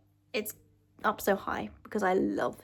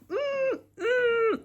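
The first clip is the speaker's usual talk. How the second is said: squeaky excited hums; high emotional hum. Real, not acted. Moved by heroes returning.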